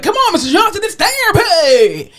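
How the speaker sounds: mock upbeat coaxing to Mister Johnson; cheery coaxing. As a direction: sell therapy like it's a party. Coaxing patient out of bed.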